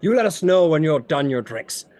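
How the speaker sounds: hearty, inquisitive character voice; lightly voiced tavern host. Froome questions the party over drinks, hearty host. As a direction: hold court with the newcomers.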